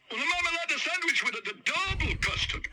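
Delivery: high mock-Italian cook; hammed-up Italian chef. Italian chef shouts the order back.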